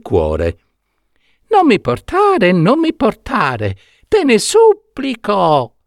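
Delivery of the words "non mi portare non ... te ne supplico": tiny voice, much higher, begging; thin little pleading voice. Acted. Trapped prince begging Saetta not to deliver him, tiny thin pleading voice. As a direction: plead from inside the box, small and thin. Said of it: "continuava ad implorare".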